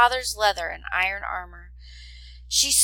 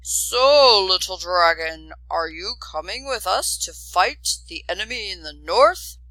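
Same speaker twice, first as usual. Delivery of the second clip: louder, menacing soldier voice; snarling soldier; 6 dB louder than usual. Acted. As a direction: sneer down at the little boy.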